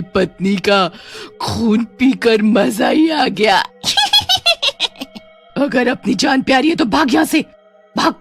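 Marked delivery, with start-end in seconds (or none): 3.6-5.2 high-pitched gloating cackle; evil witch cackle. Acted. Witch mocking the widowed husband, high evil cackle. Witch laughs after taunt.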